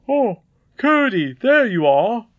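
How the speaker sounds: high, loud call to a son; glad greeting. Acted. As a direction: call out the greeting, drawn out at first. Father calls out greeting to son.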